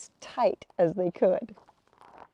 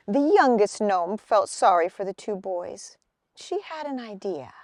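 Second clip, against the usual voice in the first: ordinary delivery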